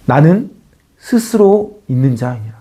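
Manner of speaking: low, slow, solemn voice of God; 하나님의 목소리. Acted. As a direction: weigh each word like a divine answer. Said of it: "하나님은 이렇게 대답하십니다". (낮고 느리게, 장엄한 하나님의 목소리)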